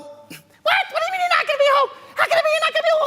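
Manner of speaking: high, fast, shocked wife on the phone. Acted. Husband calls his wife, she answers high and alarmed. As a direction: squeak out the wife's alarm in a high voice.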